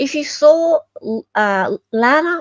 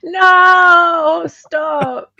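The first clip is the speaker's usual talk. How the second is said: laughing protest; 8 semitones higher, 8 dB louder than usual. Real, not acted.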